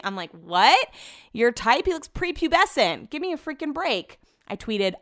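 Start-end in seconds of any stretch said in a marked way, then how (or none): 0.3-4.2 higher-pitched retold disbelief; mock incredulous. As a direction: play up the disbelief, pitch climbing after the question. Retells her disbelief at friend's taste.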